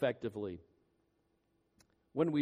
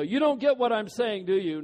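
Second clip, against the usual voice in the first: teasing challenge; 6 semitones higher, 7 dB louder than usual. Retold or imitated. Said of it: "and they go".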